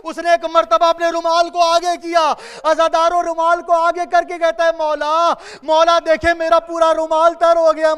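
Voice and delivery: loud, high, wailing majlis recitation; mourning sermon. Acted. Preacher driving mourners to weep, loud wailing recitation. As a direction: preach the lament with rising grief.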